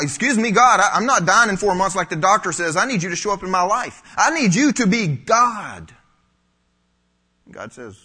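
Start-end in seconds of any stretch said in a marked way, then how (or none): 0.0-5.9 loud, high indignant plea to God; upset demand. Acted. Cancer patient demanding that God show up, upset and loud. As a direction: demand it from God at full voice, pitched up. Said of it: "we go getting all upset".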